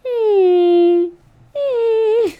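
high loud delighted hum; swooning hum. Real, not acted. After his big squeeze, high delighted hum.

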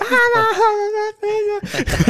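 very high mock crying sound; high baby wail. Dad imitating his baby's crying sounds. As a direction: wail like a baby, half laughing. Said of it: "just making my own sounds".

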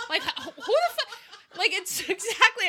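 high voice broken by laughter; giggling disbelief; laughing. Real, not acted. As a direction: laugh through the words. Laughing at absurd diet advice.

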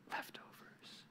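whispered, disgruntled grumble; grumbling complaint. Acted. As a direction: grumble it under the breath, barely audible. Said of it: "Grumbling, complaining".

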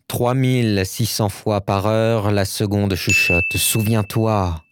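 slow, low theatrical declamation; hammy stage actor. Shown as a demonstration. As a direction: declaim it like a tragedian. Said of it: "Je vais vous déclamer une phrase de Baudelaire".

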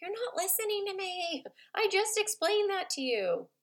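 high, put-on kid voice complaining; whiny little kid accusing a parent; child complaining. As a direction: play the hurt child who isn't heard. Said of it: "They'll even be like".

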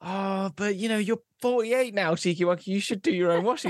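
a hint of reluctant parental scolding; mildly chiding parent. Parents answering their grown son about the washing, lightly acted chiding.

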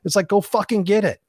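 rally them to go get it; fired-up rant. Real, not acted. Coach firing up listeners to chase their goals.

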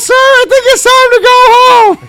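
very high, slow cartoon falsetto; drawn-out high cartoon voice; Mickey Mouse. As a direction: squeak it politely like a mascot bouncer. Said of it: "Mickey Mouse bounced you the fuck out".

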